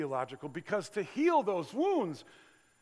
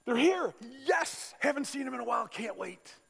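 high, bright eager greeting; light up at seeing an old friend; thrilled to see them, rising with joy; delighted; 9 semitones higher, 4 dB louder than usual. Retold or imitated.

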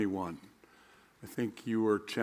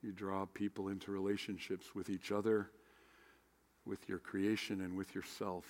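prayer; 3 semitones lower, 9 dB quieter than usual. Acted. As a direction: pray quietly and earnestly. Leads opening prayer.

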